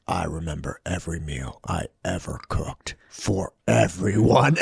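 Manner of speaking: low, weighty imitation of a brooding chef; solemn movie chef. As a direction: deliver it gravely, like a film climax. Acting out Cage's solemn climactic line.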